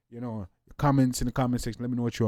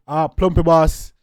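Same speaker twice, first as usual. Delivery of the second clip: hype call; 3 semitones higher, 11 dB louder, slower than usual. Acted. Hyping the artist name.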